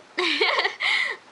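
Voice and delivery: high, loud giggling burst; high giggle. Real, not acted. Vlogger delighted by the kiss, high loud giggle. Laughing after cute kiss.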